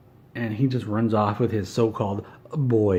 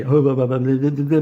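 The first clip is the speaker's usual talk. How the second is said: loud mock gibberish, pitched up; mocking babble; 3 semitones higher, 8 dB louder than usual. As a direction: throw the stammering back at him loudly. Mocks rival's stammering back at him.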